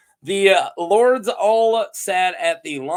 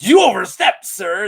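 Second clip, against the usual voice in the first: mock indignant; 3 semitones higher, 8 dB louder than usual. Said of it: "Lady Forlorn like".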